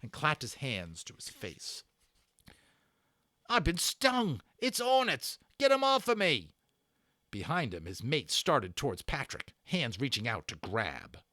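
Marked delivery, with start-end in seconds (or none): 3.5-6.5 high, loud panicked cry; pained howl. A narrator's reading. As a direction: yelp as if stung by hornets. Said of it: "howl of pain and outrage".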